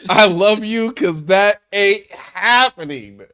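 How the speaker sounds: laughing through the words; chuckling mock declaration. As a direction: crack up while quoting.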